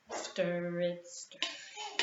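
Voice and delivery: ordinary talk, nothing marked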